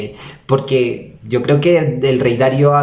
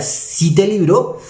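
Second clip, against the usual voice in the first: slow, doubting question rising at the end; hesitant, disbelieving ask; doubtful question; 5 semitones higher, slower than usual. Acted. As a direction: ask it as if you can't quite believe it. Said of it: "hace esa pregunta como en duda".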